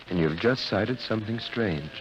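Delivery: ordinary talk, nothing marked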